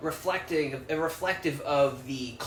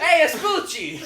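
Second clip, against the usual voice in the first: mock Italian; 13 semitones higher, 8 dB louder, slower than usual. Mocking Italian name, laughing.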